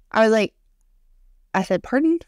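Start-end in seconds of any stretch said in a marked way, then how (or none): 1.8-2.2 much higher, shocked "Pardon?"; startled pardon. Shown as a demonstration. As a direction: recoil in disbelief on "Pardon?". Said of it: "Is how I read that".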